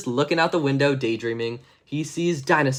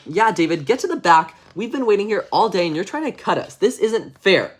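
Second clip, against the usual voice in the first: louder, higher complaining crowd; angry kids; 5 semitones higher, 4 dB louder than usual. Acted. Kids in line yell at David for cutting, loud. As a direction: gang up on the line cutter. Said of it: "everyone else in the line is like".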